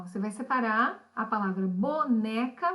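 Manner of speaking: ordinary talk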